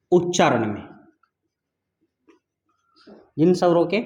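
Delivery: words spaced out with long pauses; word by word, like dictating notes. Shown as a demonstration. Teacher dictates the definition to students.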